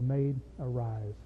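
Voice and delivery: put-on preacher softly repeats Jesus raising the girl, hushed and slow; hushed command. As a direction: let it fall to a tender hush. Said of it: "And he said".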